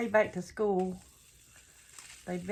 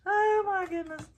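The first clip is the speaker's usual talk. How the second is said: surprised; 11 semitones higher, 5 dB louder than usual. Real, not acted. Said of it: "I am overfilling this thing".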